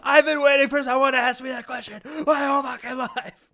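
finally asked, he gushes; eager old man. Pretend.

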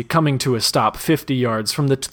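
usual speaking voice

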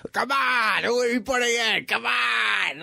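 high, shouted mock nagging; pestering shout. Friends badgering him for his heavyweight pick, high mock shouting. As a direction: yell it like a pushy friend begging for the pick. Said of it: "of course they're going".

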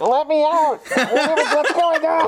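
high, slow, far-off cry from inside the sleeping bag; mock muffled cry from a zipped bag; muffled trapped kid. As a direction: plead to be let out, high and drawn out. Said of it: "he's like".

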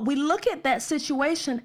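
ordinary talk

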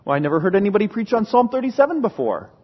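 normal talking voice

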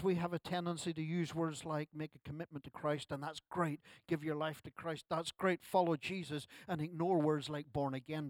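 ordinary delivery

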